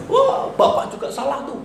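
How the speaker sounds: mock accusation. Church member criticizing leader.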